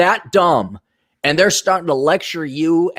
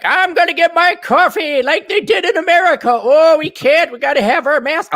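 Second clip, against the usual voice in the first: mock Southern accent; 13 semitones higher, 4 dB louder than usual. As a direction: put on a silly Southern old-timer voice. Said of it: "you guys all of a sudden have Southern accents".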